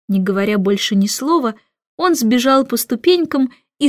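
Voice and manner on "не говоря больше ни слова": ordinary delivery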